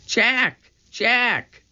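high, repeated call; calling back, pitched high but not shouted; crying out. A narrator's reading. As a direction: cry the name out twice. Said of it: "cried Annie".

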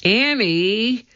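high, rising call; mild shout. A narrator's reading. Jack alone in the dark calls Annie, mild shout. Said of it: "Jack shouted".

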